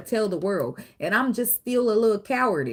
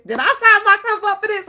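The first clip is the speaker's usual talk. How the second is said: exasperated; 13 semitones higher, 8 dB louder than usual. Acted. Exasperated question to the Lord.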